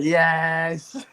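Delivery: sudden delighted high yelp of relief; excited yes. Retold or imitated. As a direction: blurt it out, overjoyed to be saved. Relief at finding bags at reception.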